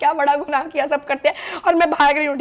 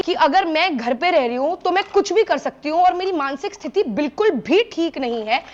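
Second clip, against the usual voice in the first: ordinary talk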